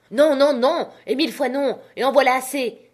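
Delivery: loud, high furious refusal; shouting captain. A narrator's reading. Captain shouting down his visitor in a furious roar. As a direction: bellow it like an enraged old sailor. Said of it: "cria-t-il une fois".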